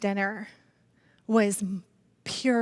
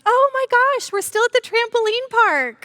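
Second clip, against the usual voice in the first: high, bright mock teen voice, much higher; mock daughter; 12 semitones higher, 5 dB louder than usual. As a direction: imitate the daughter's breezy excuse, pitched way up. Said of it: "and she's like".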